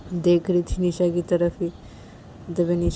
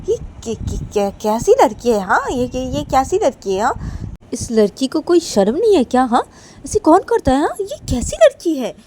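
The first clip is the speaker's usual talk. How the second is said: shrill outraged rant climbing louder; 8 semitones higher, 9 dB louder than usual. Acted. Sneha to Neha, outraged shouting about the girl. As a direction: explode in disbelief at the girl.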